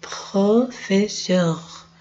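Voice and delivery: Professeur said slowly with a French uvular R; teacher modelling the French word slowly; slow French model. Shown as a demonstration. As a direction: stretch out Professeur, French R in the throat. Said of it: "veja que o R tá vendo aqui".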